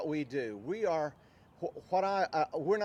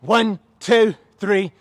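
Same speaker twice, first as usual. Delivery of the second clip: loud drill-style cadence; shouted count; 4 semitones higher, 15 dB louder, much slower than usual. Real, not acted. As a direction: shout the numbers in steady rhythm. Trainer calls out exercise count.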